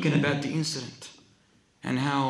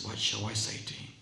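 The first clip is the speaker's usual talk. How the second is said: scared, feeble murmur; 6 dB quieter, faster than usual. Acted. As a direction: let it out faintly, as if dying. Scared dying man's last words.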